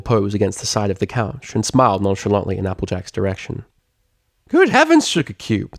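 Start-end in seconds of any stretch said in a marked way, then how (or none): 4.5-5.8 put-on shocked high cry in a country accent; gasping Southern surprise. A narrator's reading. Applejack shocked at muddy Octavia, Southern gasp. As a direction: gasp at the sight.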